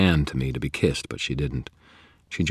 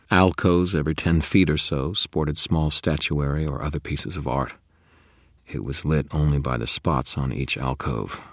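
ordinary delivery

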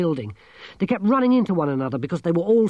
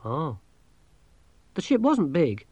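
ordinary delivery, nothing marked

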